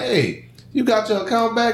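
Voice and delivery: lightly voiced high, cheery check-in; mock friendly question. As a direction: ask brightly, fishing for the truth. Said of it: "I'm like".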